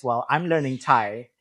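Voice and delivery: ordinary talk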